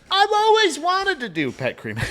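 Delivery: high, laughing mock enthusiasm; mocking wannabe comedians, giggling; mock eager dreamer. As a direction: gush about a silly dream job. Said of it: "Nobody says".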